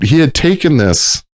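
normal talking voice

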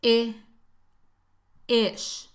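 "I" as a short lax ih, then "ish"; isolated short ih and "ish", pause between; short relaxed vowel. Shown as a demonstration. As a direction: sound out a loose ih, then "ish".

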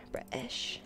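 hushed British accent, dropping the T; quick, soft British accent sample. Shown as a demonstration. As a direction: say it British, with a glottal stop. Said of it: "And British".

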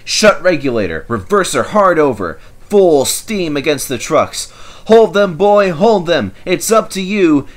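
loud, urgent orders; urgent commands. A narrator's reading. The driver urging Toby to hold, loud and urgent. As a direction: bark the orders fast.

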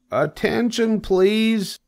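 very high, formal call for attention. Acted. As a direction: announce it firmly in a high pig voice.

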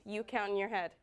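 ordinary delivery

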